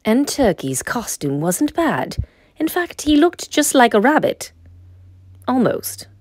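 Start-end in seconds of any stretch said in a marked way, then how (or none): none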